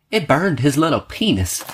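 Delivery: high, slow put-on mother's voice; imitating the mother. Mother complains, voiced again.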